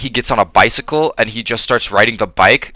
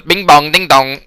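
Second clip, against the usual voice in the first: louder, higher nonsense chant; garbled cartoon voice; 5 semitones higher, 5 dB louder than usual. Imitating an eighties Transformers character, high loud gibberish voice. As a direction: imitate a robot talking in nonsense syllables. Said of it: "that guy who talked like that".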